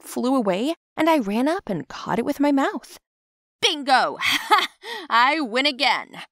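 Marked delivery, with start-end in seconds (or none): 3.6-6.2 gleeful winner's crow with a laugh; triumphant yell. Acted. Girl celebrating her grape catch, gleeful triumphant yell. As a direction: crow over the win, higher, drawing it out. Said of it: "I yelled".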